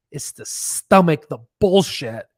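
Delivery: ordinary delivery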